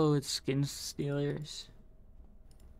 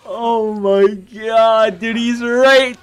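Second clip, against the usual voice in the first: panicking gamer; 7 semitones higher, 11 dB louder, much slower than usual. Real, not acted. Monster near, tense moment.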